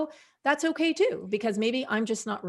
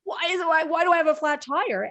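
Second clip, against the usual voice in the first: mock-flustered, higher and quicker; flustered reaction; 7 semitones higher, 4 dB louder than usual. Host imagining a menopausal listener finding a flat, mock-flustered and fast. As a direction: play the frazzled driver spotting the flat.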